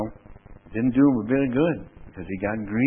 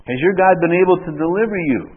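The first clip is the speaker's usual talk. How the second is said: loud, raised-pitch plea; calling out; 7 semitones higher, 10 dB louder than usual. Acted. King asks Daniel whether God delivered him, loud cry. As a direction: call the question out as the anxious king.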